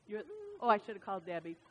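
usual speaking voice

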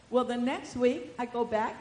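ordinary delivery

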